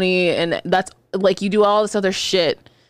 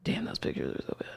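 hushed, unvoiced whisper; 15 dB quieter than usual. Real, not acted. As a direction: whisper it in shock. Shocked at the photos.

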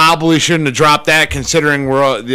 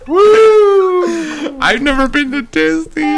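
high shouted whoop and laugh; excited whoop; 13 semitones higher, much slower than usual. Real, not acted. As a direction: whoop it out, thrilled.

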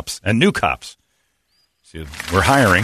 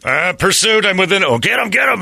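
excited radio call; 11 semitones higher than usual. Retold or imitated. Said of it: "the guy would go by us and go".

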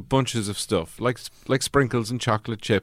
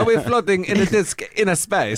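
high, laughing mock Italian accent; 10 semitones higher than usual. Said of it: "much more fun to do it in an Italian accent".

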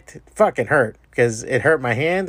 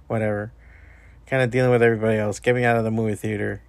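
normal talking voice

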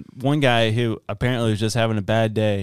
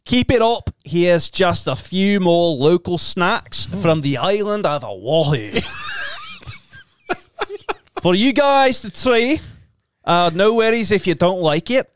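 put-on sing-song Hawaiian accent, higher; 4 semitones higher than usual. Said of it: "In a Hawaiian accent".

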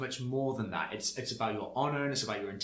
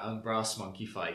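ordinary talk, nothing marked

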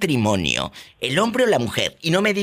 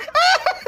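shrill-pitched put-on cackle, much higher. Host cackling at the guest's confession in a high voice.

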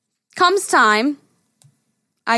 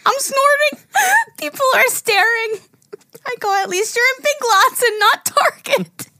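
laughing; 13 semitones higher than usual. Retold or imitated. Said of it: "Mom goes".

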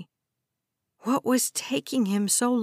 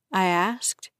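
plain reading voice

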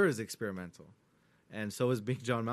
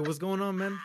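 attempted British accent, higher and amused; 7 semitones higher than usual. Fan re-enacting actor's hello, light British accent. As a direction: retell the greeting with a hint of British accent. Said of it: "He did his British accent".

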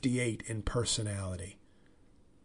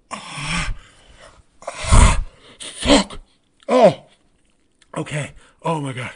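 hammed-up moaning cries, louder and higher; mock ecstatic moans; 4 semitones higher, 15 dB louder, slower than usual. Acting out his fantasy sex scene with her, moaning loudly. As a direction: ham up a climax, yelping out loud.

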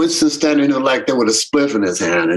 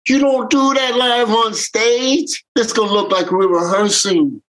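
a telling-off from the stage; scolding bandmate; 9 semitones higher than usual. Retold or imitated. Warning the guitar player on stage, high scolding voice. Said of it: "I said".

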